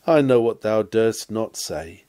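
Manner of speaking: ordinary talk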